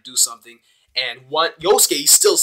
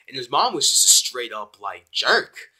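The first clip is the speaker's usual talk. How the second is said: ordinary talk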